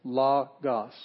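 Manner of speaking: "logos" divided into a firm LO and a falling GOS; syllable by syllable. Shown as a demonstration. Teacher counting syllables for students, "logos" said in two parts. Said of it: "So it's two syllables".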